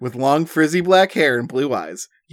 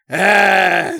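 scream; 6 semitones higher, 5 dB louder than usual. A narrator's reading. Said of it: "she screams at the top of her lungs".

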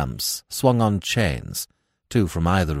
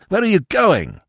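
sharp, high and loud demand; snapped; 11 semitones higher, 6 dB louder than usual. A narrator's reading. Smith grips his friend's arm, snapping sharply. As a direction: snap it out to stop him. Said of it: "he snapped".

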